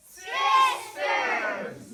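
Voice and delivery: a loud, annoyed correction; indignant shout. Acted. Sister pig shouting to correct her brother, loud indignant yell.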